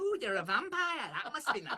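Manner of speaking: put-on high laughing English accent; mock English gossip. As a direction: coo at the vampire like a delighted English lady. Joking English vampire scene.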